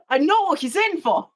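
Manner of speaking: breaking into laughter on the words; laughing. Real, not acted. Officer remembering what the prisoner was in for, laughing. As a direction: crack up while telling it, pitch climbing. Cracking up at own story.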